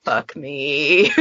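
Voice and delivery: low, laughing mock despair; laughing groan. Facing a two-hour file, laughing mock despair. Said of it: "I'll be like".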